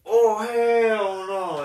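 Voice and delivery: drawn-out, loud, high "oh hell no"; disgusted shout. Retold or imitated. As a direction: yell it slowly in horror. Reliving the disgusting moment.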